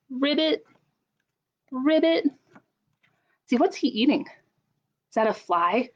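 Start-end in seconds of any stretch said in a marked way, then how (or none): 0.1-2.3 put-on high, bright frog croak for the kids; frog ribbit. Shown as a demonstration. Librarian voicing a frog for children, high ribbit. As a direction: say it like a little frog. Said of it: "All I know is frogs go".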